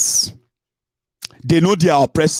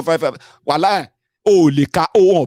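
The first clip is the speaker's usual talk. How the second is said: normal talking voice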